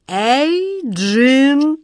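a king's call cracking into a shrill yelp; pitiful squeal. Acted. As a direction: voice cracks into a high, loud squeal. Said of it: "голос его сорвался на жалкий визг".

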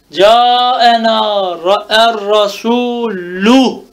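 slow recitation, drawn-out final "u" in "rasulu"; audible damme ending. Shown as a demonstration. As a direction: stretch "rasulu" and land clearly on its u ending. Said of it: "Merfun bid dammeti zahira". ("rasulu" sonundaki ötreyi uzatarak, belirgin oku)

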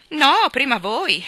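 slow, loud and high courtly insisting; polite stranger. Acted. Stranger refusing to go first, slow and courtly.

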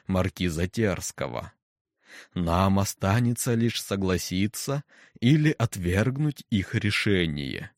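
slow, deep, impassive voice; deep stern necromancer. Acted. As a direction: hold the hall with a low voice. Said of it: "Грозный голос некроманта гулко падал в тишину, глубокий, низкий, бесстрастный".

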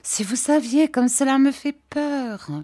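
put-on high-pitched, childlike plaint; mildly fearful girlish confession; frightened. A narrator's reading. Dora confesses her fear.

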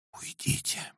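tearful soft whisper. A narrator's reading. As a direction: whisper it through tears. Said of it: "прошептала".

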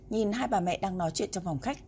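ordinary delivery

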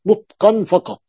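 ordinary talk

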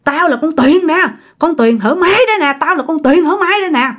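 high-pitched, indignant scolding; acted spirit's angry rant; possessed woman's accusation. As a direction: insist on who you are, then curse your friend. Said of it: "con quỷ".

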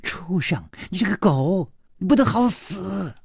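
put-on high-pitched enraged girl's abuse; shrill outraged swearing; cursing furiously. A narrator's reading. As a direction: spit out curses at the captor. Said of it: "在破口大骂".

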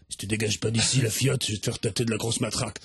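low, gruff bouncer voice; stern guard. Acted. Guard refuses the fans at the hotel door, low and stern. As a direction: block them like a stone-faced guard.

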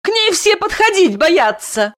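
very high, agitated wife voice; angry cry. A narrator's reading. Wife complains to husband that everyone fears the child, angrily. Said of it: "зло крикнула Дарья".

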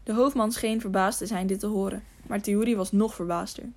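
plain reading voice

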